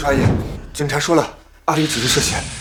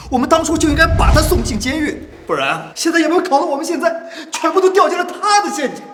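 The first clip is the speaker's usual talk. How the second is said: high, agitated outburst; heated, raised-pitch blame; angry regret; 8 semitones higher than usual. Acted. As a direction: rant at having been tricked. Angry regret on discovering the culprit.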